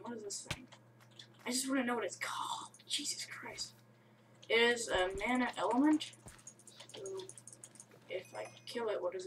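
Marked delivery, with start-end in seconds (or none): 0.0-3.7 breathy whispered aside; whispering to himself in surprise. Real, not acted. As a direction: mutter it under your breath, then gasp the curse. Curious, then startled under breath.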